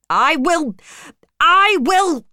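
loud, high, building insistence; angry. A narrator's reading. Rochester retorts to Jane's leaving, angrily. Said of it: "he said angrily".

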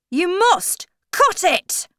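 bossy, high, slow character order; Mad Hatter. A narrator's reading. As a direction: give a loud, deliberate order. Hatter orders Alice, voiced.

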